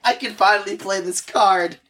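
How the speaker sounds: high, gleeful cheering; thrilled to finally play it; excited. Real, not acted. Gleeful at finally playing card.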